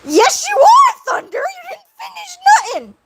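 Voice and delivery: high squealed protest fading into laughter; playful scolding. Real, not acted. Streamer telling off Thunder for unfinished writing, squealed scolding. Mock-scolding a chat friend.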